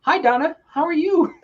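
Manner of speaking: sudden high, loud phone greeting; imitating chirpy mom. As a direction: switch to a sing-out hello for a friend. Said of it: "and she'll be like".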